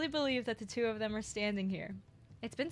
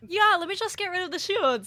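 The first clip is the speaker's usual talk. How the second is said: mock careless Parfait; 10 semitones higher, 11 dB louder than usual. Mocking Parfait's careless power.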